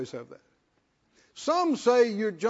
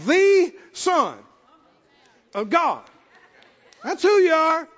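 louder and higher, building; rising proclamation; 7 semitones higher, 7 dB louder, slower than usual. Retold or imitated. Peter proclaims to Jesus, growing loud. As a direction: build it up to a ringing close.

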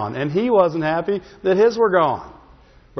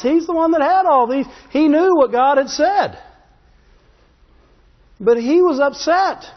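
fiery preaching; 8 semitones higher than usual. Acted. Pastor to congregation about David's promise, fiery preaching. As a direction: drive it home like a revival preacher. Preaching builds to full force.

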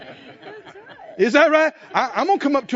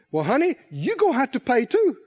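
ordinary talk

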